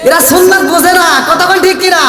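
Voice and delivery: high, loud preaching push; fervent rise. Real, not acted. Preacher pushing the joke to the crowd, high and loud. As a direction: drive it hard at the crowd.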